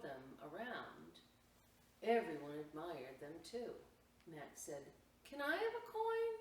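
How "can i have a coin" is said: put-on high, louder child's plea; small boy begging for a coin; pleading little brother. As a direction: ask sweetly like a small boy.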